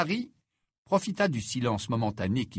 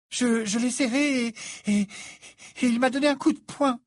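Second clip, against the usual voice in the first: high, loud stammering gasp; stunned and out of breath; breathless; 13 semitones higher, 5 dB louder than usual. Acted. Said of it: "s'exclama-t-elle, le souffle coupé".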